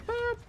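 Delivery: very high, loud little boop; playful boop. Real, not acted. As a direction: squeak out a short, loud boop. Playful noise while shooting in game.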